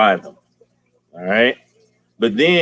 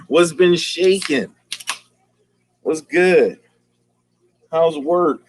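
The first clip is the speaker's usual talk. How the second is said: excited greeting; 7 semitones higher, slower than usual. Real, not acted. Host greets viewers not seen since Monday, excited drawn-out greeting. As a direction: stretch it out high, then ease down.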